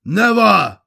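high, loud shout of refusal; defiant cry. A narrator's reading. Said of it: "Al Kahan cried".

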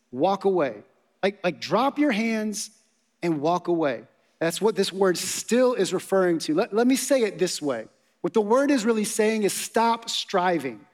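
ordinary talk, nothing marked

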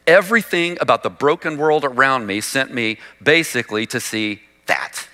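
normal talking voice

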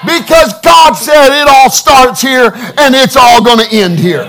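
high, loud, rousing sermon; impassioned pulpit shout, punching the key words; full-force preaching. Real, not acted. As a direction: proclaim victory to the congregation at full voice.

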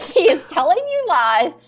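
loud, high emphatic "telling you lies". Acted. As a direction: belt out "lies" for the back row. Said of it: "Say it louder for the people in the back".

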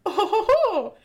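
put-on high, loud jolly-old-chap chortle; hammed-up British "ho, ho" character voice; Prime's mock voice. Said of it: "that's the voice that he has".

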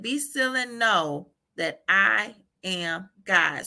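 slow, with pauses around "I am God"; deliberate quoting. Retold or imitated. Speaker repeats God's words from hard seasons, slow and deliberate. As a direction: measure out "that I am God" one word at a time.

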